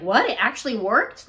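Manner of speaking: loud, pitch-leaping mock astonishment; shocked. Imitating partner hearing of the pregnancy, shocked. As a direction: blurt it out in imitated disbelief. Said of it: "He's shocked".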